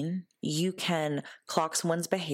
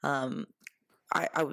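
ordinary talk

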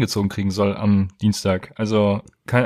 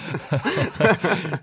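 helpless giggle; burst of laughter; 6 semitones higher, 3 dB quieter than usual. Real, not acted. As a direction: break up laughing. Host breaks into laughter.

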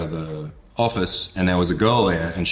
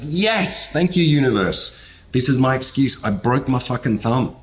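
higher, thrilled burst of relief; elated; 6 semitones higher than usual. Retold or imitated. Student thanking the universe for a broken thumb, elated and higher. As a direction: cheer like you just got out of homework. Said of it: "And I felt elated".

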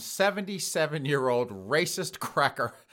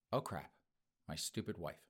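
quiet husband voice; 8 semitones lower, 14 dB quieter than usual. Acted. Voicing the husband.

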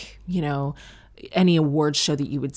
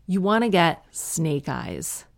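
usual speaking voice

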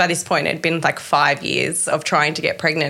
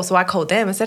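ordinary talk, nothing marked

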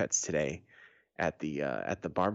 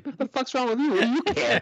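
high, indignant outburst growing louder; indignant retort; 5 dB louder, faster than usual. Acted. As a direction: throw it back as a defensive challenge. Acting his retort to the critic.

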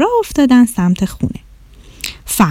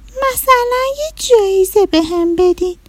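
little rabbit; 10 semitones higher than usual. Acted. Rabbit asking the teacher for a prize, high childlike voice. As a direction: ask sweetly like a small bunny.

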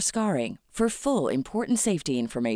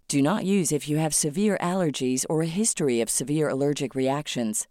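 normal talking voice

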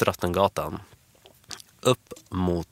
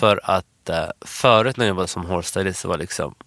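usual speaking voice

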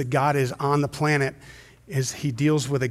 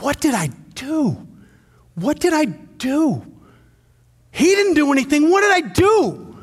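slow, much higher, breaking cry of guilt; anguished self-questioning; 11 semitones higher, 5 dB louder, slower than usual. Acted. Believer seeing the crucified man, anguished slow cry. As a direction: ask it like the guilt is crushing you.

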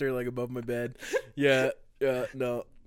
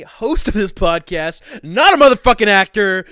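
1 mock proud shout; 9 semitones higher, 17 dB louder than usual. Mock-proud self introduction.

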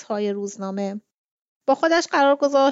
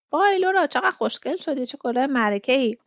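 admiring amazement; 4 semitones higher than usual. Acted. Brother seeing his sister in her hat, amazed. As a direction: gush in surprise at how lovely someone looks.

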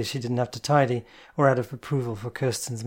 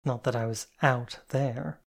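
usual speaking voice

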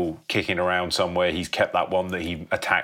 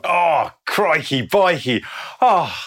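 higher, slow, hammed-up gasp of shock; comic exclamation; 8 semitones higher, 4 dB louder, much slower than usual. Real, not acted. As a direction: stretch it out like a music-hall reaction. Reacting to the plot twist.

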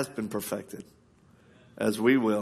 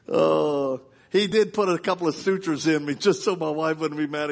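ordinary talk

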